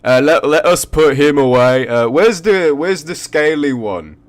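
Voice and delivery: high, loud goofy guard; clumsy cheerful guard voice; dopey guard voice. Acted. Guard NPC continues.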